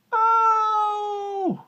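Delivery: startled elephant. Acted. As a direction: yelp at the bite. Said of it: "in his big, deep voice".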